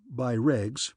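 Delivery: plain reading voice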